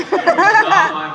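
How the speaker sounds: high, loud, slow and laughing; laughing protest. Real, not acted. Actor laughingly denies blame for the fan's tears. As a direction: protest through a big laugh.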